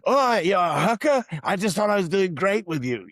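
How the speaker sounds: high, put-on British accent; Arthur's British accent. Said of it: "I can do Arthur".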